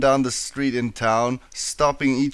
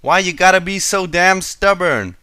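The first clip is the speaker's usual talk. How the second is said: higher, louder annoyed outburst; a cross farm pony shout, higher and louder; frustrated shout; 6 semitones higher, 5 dB louder than usual. A narrator's reading. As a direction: yell at the stubborn tree. Applejack kicking tree.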